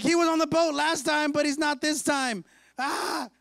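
high-pitched mock panic; panicked wail. Disciples realizing Jesus is not on the boat, hammed-up panic. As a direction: fret aloud, ending in a yelp.

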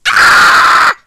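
loud wordless scream of rage; angry yell. Real, not acted. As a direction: let out a sharp shout of anger. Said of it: "I always yell that with my anger".

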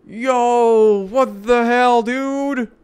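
high, loud disbelieving yell; real what the hell shout; shocked shout. Real, not acted. Reacting to game twist.

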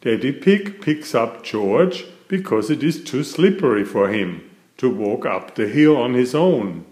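plain reading voice

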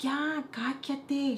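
high, very drawn-out brush-off; mock dismissive hushing. Cousin hushes smack-talking little girl, drawn-out mock annoyance. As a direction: imitate someone silencing a kid, stretching the words.

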